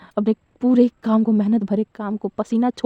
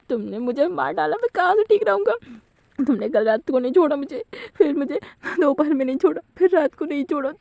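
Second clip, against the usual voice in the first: whiny complaint; 5 semitones higher than usual. Acted. Wang Ji complaining about the nights.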